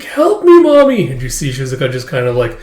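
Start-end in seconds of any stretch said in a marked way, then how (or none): 0.0-1.1 put-on very high, loud child's wail; desperate cry. Captive girl calling for her mother, desperate.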